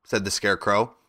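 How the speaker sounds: plain reading voice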